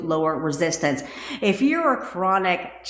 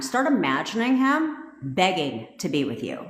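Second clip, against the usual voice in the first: ordinary talk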